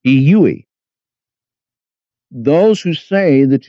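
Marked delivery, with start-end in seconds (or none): 0.1-0.6 Iui said as "ee-YOO-ee"; phonetic pronunciation. Shown as a demonstration. Teacher reading the phonetic spelling, Iui as "ee-YOO-ee". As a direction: sound out Iui in three vowel syllables. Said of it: "that would be pronounced".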